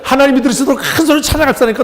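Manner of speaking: high, loud, quick mimicry of a praise leader; mock a rousing call to praise; worship leader. Worship leader rallying the young people to sing, mimicked high and loud. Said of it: "찬양 인도하는 사람이 그러더라고요".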